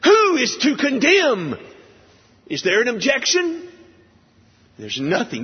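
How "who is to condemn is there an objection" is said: higher, louder, ringing question; Paul's defiance voiced by the preacher; defiant challenge. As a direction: stress on "Who", daring an answer. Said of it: "That's the scene, the tone".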